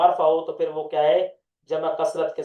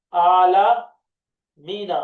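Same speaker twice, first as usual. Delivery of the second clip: slow drawn-out word; 5 dB louder, much slower than usual. Shown as a demonstration.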